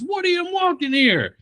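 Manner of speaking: high, hammed-up mock New York shout; New York street yell.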